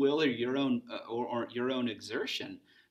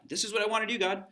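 much higher, louder eager plea to God; eager student; 10 semitones higher, 4 dB louder, much faster than usual. Retold or imitated. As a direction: pitch it up like a hopeful teen asking God. Student presenting plans to God.

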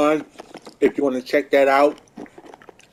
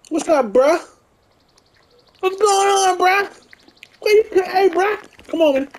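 high-pitched calling out; 3 dB louder than usual. Acted. Greeting a game character loudly.

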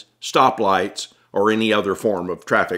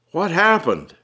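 raised, higher-pitched question to the cop; Tom, startled question; 8 semitones higher than usual. Acted. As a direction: call out over the noise of the fire. Tom asks cop at fire.

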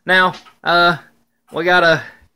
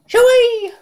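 excited squeal. Real, not acted. Excited at the food.

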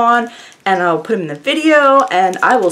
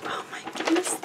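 awed whisper; 9 semitones higher, 14 dB quieter, faster than usual. Real, not acted. Whispered awe at nail designs.

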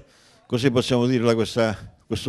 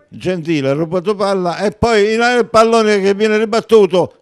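breathless italian goal commentary; 8 semitones higher, 7 dB louder than usual. Real, not acted. Goal call build-up.

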